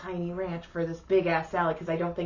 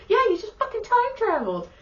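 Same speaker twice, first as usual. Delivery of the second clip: sarcastic retort; faster than usual. Retold or imitated. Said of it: "And I'm all".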